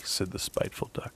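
ordinary delivery, nothing marked

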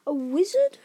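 halting, high-pitched astonishment; astonished boy. A narrator's reading. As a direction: stumble over the news, then lift the last word. Harry reacts in astonishment.

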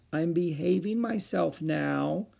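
put-on high, slow little-girl voice; meek. A narrator's reading. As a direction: offer it timidly, drawn out. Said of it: "she said meekly".

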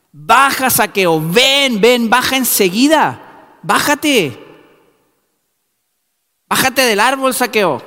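high, loud calls to come down; shouting up. Acted. Jesus shouting up to Zacchaeus in the tree, loud and high. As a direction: call him down from the tree, insistent. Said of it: "Y ahí dice que le gritó".

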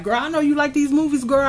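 high, fast, excited coworker voice; gossipy coworker. Retold or imitated. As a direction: rush it like a coworker bursting with a tip. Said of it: "was like".

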